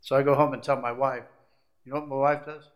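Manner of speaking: ordinary delivery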